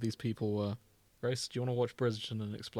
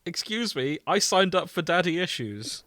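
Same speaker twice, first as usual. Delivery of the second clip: mock outrage; 6 semitones higher, 5 dB louder than usual. Mock indignant complaint.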